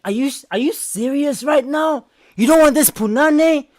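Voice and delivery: high-pitched mock indignation; incredulous girlfriend. Girlfriend challenging her reluctant boyfriend, mock incredulous. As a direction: mimic her disbelief, pitched well up. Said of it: "She's like".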